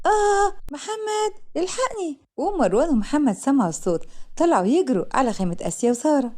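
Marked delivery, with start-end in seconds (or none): 0.1-2.2 high panicked cry for help, breaking off; scream. A narrator's reading. Asia screams in terror in the night tent. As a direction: shriek for help at a snake in the tent. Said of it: "آسيا صرخت".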